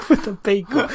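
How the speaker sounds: giggling out the punchline; laughing. Pretend. Laughing New York joke.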